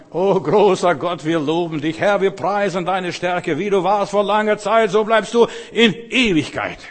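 loud, high, drawn-out praise to God; Lobpreisgebet. Shown as a demonstration. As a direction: proclaim it as a soaring prayer of praise. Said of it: "wir sollten anfangen, wenn wir beten, anfangen". (lautes, feierliches Lobpreisgebet)

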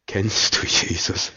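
slow, intense whisper; hushed and searching; whispered question. Real, not acted. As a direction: breathe the question out slowly to the listeners. Preacher's hushed question to listeners.

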